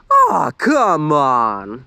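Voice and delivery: slow, drawn-out teasing whine; taunting. A narrator's reading. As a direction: tease them, stretching it out. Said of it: "Luann taunted".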